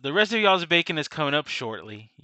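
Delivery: loud innkeeper shout from the back; calling out. Acted. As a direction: holler the order update across the inn. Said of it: "You hear him calling out from the back".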